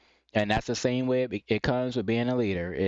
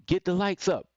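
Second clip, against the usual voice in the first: higher, direct streamer command; confident call for likes; assertive, not forceful; 5 semitones higher than usual. Retold or imitated. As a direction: tell them plainly, without meanness. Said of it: "it's not mean or forceful".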